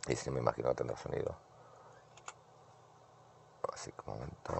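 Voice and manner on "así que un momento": whisper. Real, not acted. Whispers while visitor at door.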